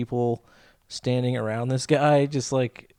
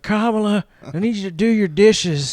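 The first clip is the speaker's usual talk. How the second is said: high, plaintive roommate nagging; Biden as roommate; 8 semitones higher, 4 dB louder than usual. Acted. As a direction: call out to your roommate in a raised, higher voice. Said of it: "I like to imagine that Biden".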